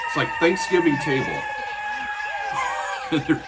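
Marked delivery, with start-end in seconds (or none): 1.4-3.1 high burst of laughter. Real, not acted. Laughing at arguing squirrels.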